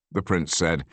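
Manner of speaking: ordinary delivery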